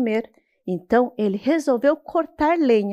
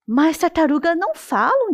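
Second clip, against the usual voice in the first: higher, astonished protest; amazed; 6 semitones higher, 3 dB louder than usual. Acted. Boy amazed that the turtle talks. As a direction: protest in disbelief. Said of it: "disse o rapaz espantado".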